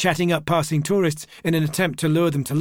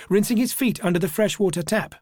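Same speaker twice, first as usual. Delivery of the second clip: usual speaking voice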